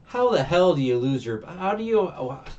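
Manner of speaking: loud, incredulous rant; can't believe she lost it; real exasperation, loud; exasperated disbelief. Real, not acted. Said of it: "And I'm thinking".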